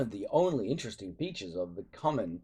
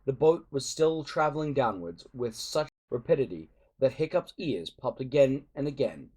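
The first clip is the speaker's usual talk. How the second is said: ordinary delivery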